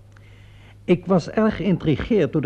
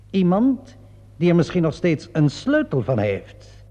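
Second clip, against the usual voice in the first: ordinary talk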